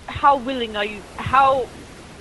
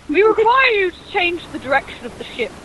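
higher, louder, laughing through words; 7 semitones higher, 7 dB louder than usual. Real, not acted. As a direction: try to speak while cracking up.

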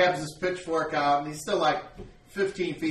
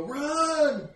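panicked shout; 13 semitones higher, 6 dB louder than usual. Acted. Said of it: "They go".

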